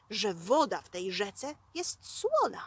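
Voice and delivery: usual speaking voice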